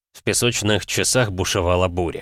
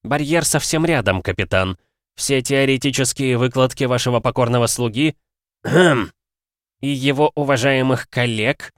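sour reluctant; 5 semitones higher than usual. Acted. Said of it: "с кислым выражением нехотя продолжил".

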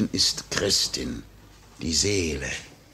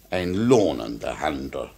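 normal talking voice

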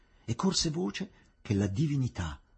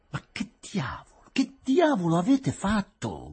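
sudden angry shout; 8 semitones higher, 4 dB louder than usual. A narrator's reading. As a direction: burst out in exasperation. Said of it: "gridò improvvisamente lui". (grido improvviso e irritato)

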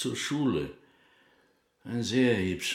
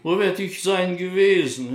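indignant; 9 semitones higher, 5 dB louder than usual. Acted. Indignant reply to police question.

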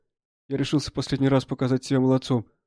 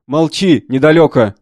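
Cossack's shout; 2 semitones higher, 10 dB louder, slower than usual. A narrator's reading. Cossack to the wounded soldier begging for help, shouting. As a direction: shout it back to silence him. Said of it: "казак кричал только".

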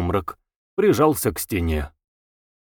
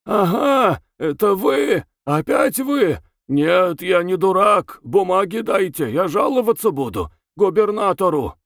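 hoarse roaring prisoner; 8 semitones higher than usual. A narrator's reading. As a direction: roar your defiance, half mad. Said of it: "раздался сиплый рёв". (сиплый рёв безумного арестанта)

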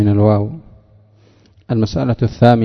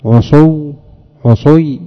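عصو then عصي said higher and louder; emphatic demo; 4 semitones higher, 4 dB louder, slower than usual. Shown as a demonstration. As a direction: punch each form of عصي to show the change. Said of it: "فيقال".

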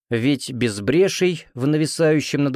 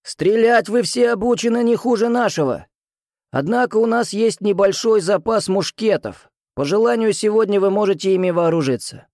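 put-on higher, rough old musketeer's bark; hoarse powerful voice; 10 semitones higher than usual. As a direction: boom it hoarsely over the ranks. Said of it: "Хриплый, но мощный голос".

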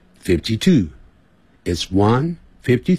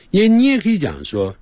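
usual speaking voice

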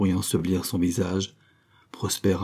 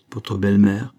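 a lightly broken question; 5 dB louder than usual. A narrator's reading. Marianne asks Prosper about his mourning, voice lightly breaking. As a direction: ask with a hint of a catch in the throat. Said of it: "demanda-t-elle d'une voix brisée".

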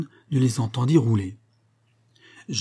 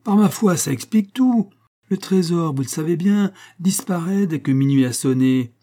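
high, lively revelation; animated explanation; 6 semitones higher, 3 dB louder than usual. A narrator's reading. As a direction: explain it all with raised, excited voice. Jacques animatedly explains the vanished treasure. (explication animée, voix haute)

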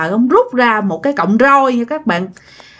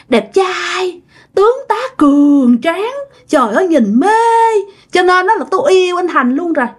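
smitten ghost; 7 semitones higher than usual. Retold or imitated. Spirit confessing her crush on Thành to the shaman, swooning and high. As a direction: sigh over him like a lovesick girl. Ghost gushing over handsome man.

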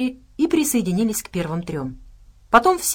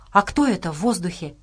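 usual speaking voice

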